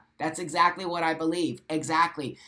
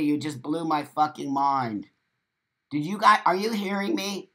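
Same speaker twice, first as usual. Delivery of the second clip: ordinary talk, nothing marked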